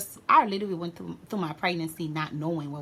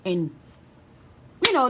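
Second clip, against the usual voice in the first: ordinary talk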